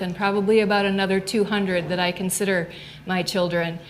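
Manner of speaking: normal talking voice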